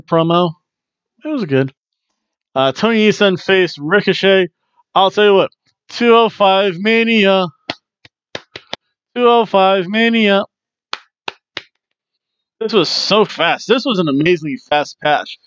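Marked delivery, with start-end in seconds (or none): 5.9-10.4 high, drawn-out announcer-like call; high chanted hype; hyped catchphrase. Pretend. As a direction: call it out high like an event slogan.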